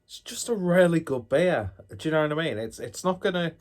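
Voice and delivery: loud, high and gushing; excited. Real, not acted. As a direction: rave about it loudly. Enthusing about the beer.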